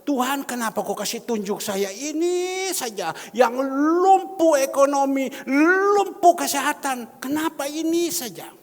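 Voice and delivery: high, loud, pleading cry to God; loud complaint. Acted. Preacher voices a complaining prayer. (keluhan keras dan tinggi kepada Tuhan)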